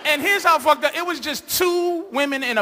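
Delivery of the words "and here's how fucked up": usual speaking voice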